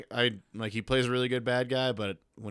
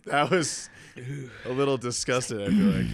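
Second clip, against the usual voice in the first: laughing; 4 semitones higher, 7 dB louder than usual. Real, not acted. Still laughing while talking.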